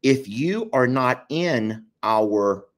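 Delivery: ordinary talk, nothing marked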